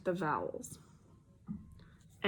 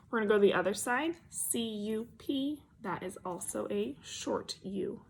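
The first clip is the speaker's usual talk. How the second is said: usual speaking voice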